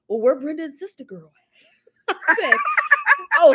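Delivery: ordinary talk, nothing marked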